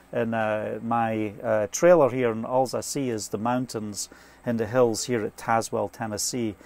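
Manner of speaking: usual speaking voice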